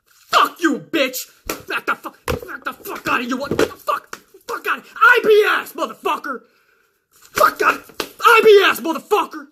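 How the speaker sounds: high shouting tirade; hammed-up furious yelling. Real, not acted. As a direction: scream him off the channel. Screaming at commenter.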